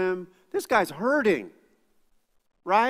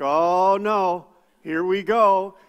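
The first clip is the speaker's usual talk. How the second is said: retold dread; 6 dB louder, much slower than usual. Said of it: "and I'm like".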